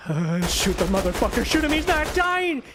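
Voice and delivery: high frantic shouting, slowed on shoot him; panicked yelling. Real, not acted. Gamer begging teammates to shoot the boss, panicked high yelling. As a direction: yell in desperate panic at the boss.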